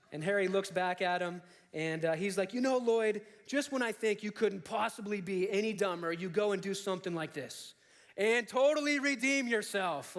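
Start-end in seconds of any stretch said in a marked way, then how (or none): 2.5-10.2 mock exasperated praise. Harry to Lloyd about the scooter, mock exasperation turning to elation.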